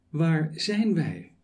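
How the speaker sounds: normal talking voice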